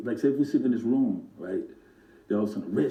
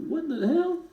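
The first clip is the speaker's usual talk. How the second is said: much higher, startled outburst; shocked; slower than usual. Retold or imitated. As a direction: blurt it out in shock at the monkey. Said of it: "I was like".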